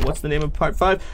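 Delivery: normal talking voice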